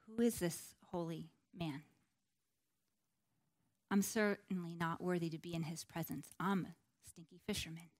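low, soft, humbled wonder; hushed awe. Acted. Simon Peter feeling unworthy before the holy man, hushed awe. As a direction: voice an unworthy man's awe quietly. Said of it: "was one of awe".

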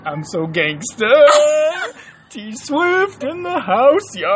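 hammed-up gangster boast, building to a loud drawn-out high shout; mock gangster. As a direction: draw it out into a mock-swaggering holler. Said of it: "how gangster I felt".

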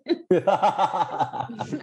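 low sinister cackle; evil laugh. Real, not acted. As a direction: laugh like an unhinged villain. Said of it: "risada maligna".

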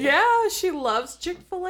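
giddy high squeal. Pretend.

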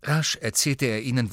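plain reading voice

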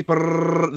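rolled-lips drumroll sound; mouth drumroll. Pretend. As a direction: trill out a quick drumroll. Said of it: "Drum roll, please".

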